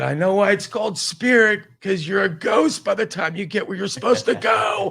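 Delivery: high, loud shouted insult; yelling. Retold or imitated. To the Spirit desk staff after his flight is cancelled: angry high shout.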